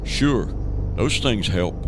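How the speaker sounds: ordinary talk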